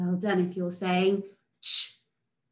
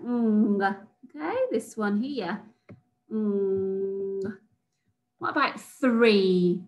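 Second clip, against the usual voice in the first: ordinary talk, nothing marked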